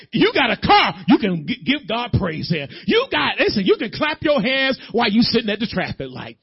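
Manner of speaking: loud, urgent church exhortation; full-force preaching. Real, not acted. Preacher urging the congregation to praise, fired-up preaching. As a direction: drive each line home at high volume.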